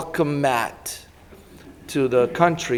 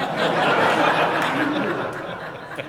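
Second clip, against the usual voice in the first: breathy laughter, high and airy; a real burst of unvoiced laughter; 10 semitones higher than usual. Real, not acted. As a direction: laugh at how well it worked. Laughing after the story.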